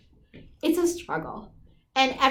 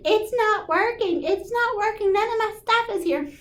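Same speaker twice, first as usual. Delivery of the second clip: much higher, whiny mock wail; mock crying wail; 10 semitones higher than usual. Retelling her phone meltdown to friends, high mock whine. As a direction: wail it like a frustrated kid, slowed at first. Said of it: "I'm in there crying".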